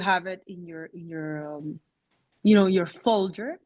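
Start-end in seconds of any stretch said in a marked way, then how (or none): none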